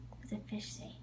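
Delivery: hushed whisper, no voice. Real, not acted. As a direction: whisper it like a secret. Quiz game with a toddler.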